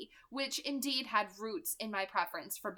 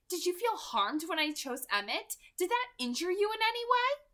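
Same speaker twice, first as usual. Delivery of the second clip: 1 Rosalie, higher questioning; 10 semitones higher, 5 dB louder than usual. Acted.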